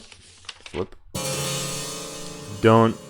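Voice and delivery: ordinary delivery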